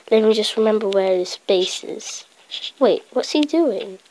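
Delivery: normal talking voice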